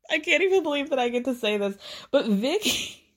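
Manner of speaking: high, fast giddy rush. Real, not acted. Vlogger teasing the big gossip, giddy and fast. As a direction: spill it out thrilled.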